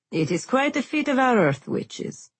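a proud host showing off; proud guildmaster voice. Acted. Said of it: "asked the ginger-haired leader".

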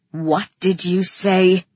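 low, slow menacing man; low-voiced threat in a male character's voice; snarling. A narrator's reading. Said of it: "he snarled at me".

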